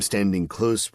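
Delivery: ordinary delivery, nothing marked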